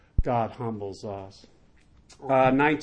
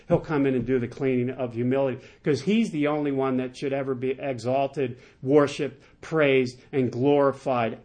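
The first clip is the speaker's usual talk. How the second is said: ordinary delivery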